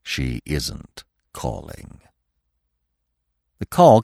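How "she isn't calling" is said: very low, slow, soft; old stag. A narrator's reading. Old stag contradicting eager Bambi, low, slow and grave. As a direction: state it gravely, slowly and quietly. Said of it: "said the old stag".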